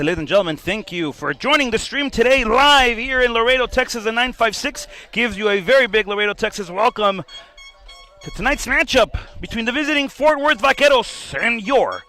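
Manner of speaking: loud, high arena welcome; stadium announcer. Acted. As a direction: pump up the home crowd.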